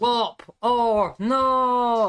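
big, slow, drawn-out game show call; game show announcer. Pretend. Co-host kicks off the game, booming like a quiz show host.

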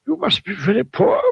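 a kind, high wizard voice; kindly wizard. A narrator's reading. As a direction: speak kindly to the poor man. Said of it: "he remarked kindly".

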